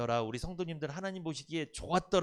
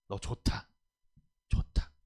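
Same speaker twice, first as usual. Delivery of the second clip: God's gentle praise; 7 semitones lower, slower than usual. Acted. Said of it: "토브 이래야 돼요".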